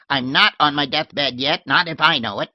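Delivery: put-on old lady shooing her maid off, tart and loud; shrill old lady. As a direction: bark it like a cranky old lady. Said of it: "she was saying tartly".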